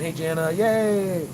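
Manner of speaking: drawn-out, happy cheer; cheery greeting. Real, not acted. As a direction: greet her with a long yay.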